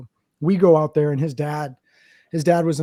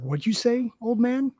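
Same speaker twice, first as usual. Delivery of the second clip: mock tough challenge; 8 semitones higher than usual. Gangbanger challenging the dad.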